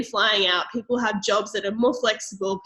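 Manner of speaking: ordinary delivery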